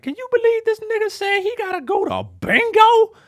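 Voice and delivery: put-on high indignant girlfriend voice; shrieking incredulous friend; mock girlfriend voice. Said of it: "her girlfriend was like".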